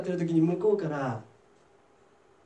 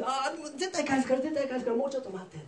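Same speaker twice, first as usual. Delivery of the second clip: high, softer acted begging; pleading; 6 semitones higher, 4 dB quieter than usual. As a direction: play a friend imploring for time. Friend begs for more time.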